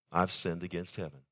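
soft, low-voiced admission of sin; quiet confession. The son confessing to his father, quiet and low. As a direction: murmur it like a broken son.

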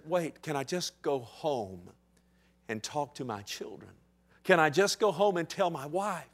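a soft, broken plea; pleading hush. Acted. Leper to the priest, begging quietly to go home. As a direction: ask it almost under the breath. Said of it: "Maybe he asked this question".

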